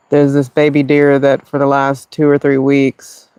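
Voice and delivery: usual speaking voice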